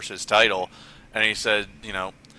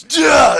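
short high, loud lunging shout; comic battle cry, pitched way up; hammed-up charging yell; mock attack yell; 12 dB louder than usual. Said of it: "And then Triple H goes".

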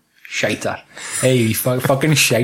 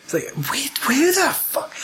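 awed whisper; 12 semitones higher, 4 dB quieter than usual. Retold or imitated. Awed reaction to Joker plan.

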